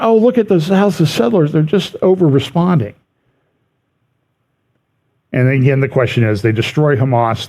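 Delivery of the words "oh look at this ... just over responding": higher mock-indignant voice; mocking critics. Host mimicking critics who blame settlers, mock indignant. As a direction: sneer it like a scolding critic.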